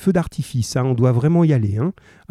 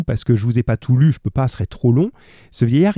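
ordinary talk

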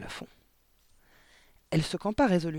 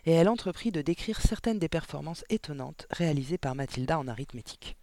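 ordinary delivery, nothing marked

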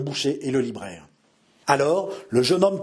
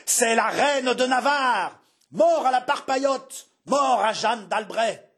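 high, slow rabble-rousing cry; stentorian roar; 10 semitones higher, slower than usual. A narrator's reading. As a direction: call it out over the clamour, drawing out the words. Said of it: "dont la voix de stentor domina les clameurs". (cri de stentor)

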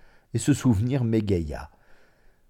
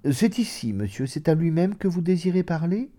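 high-pitched, polite maid's voice; lightly played servant at the door; 8 semitones higher than usual. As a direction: inquire politely in a high register. Maid answers the visitor at door.

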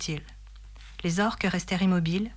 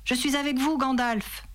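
higher rallying call; mild narrated cry; 7 semitones higher than usual. A narrator's reading. Aragorn calling to Gandalf on the bridge, mild cry. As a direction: call support without real shouting. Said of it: "cria-t-il".

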